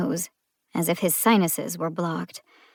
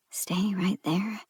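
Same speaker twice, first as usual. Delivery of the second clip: slow unvoiced whisper; muttered whisper; 6 dB quieter, slower than usual. Cop muttering an order to the driver, whispered. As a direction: barely say it.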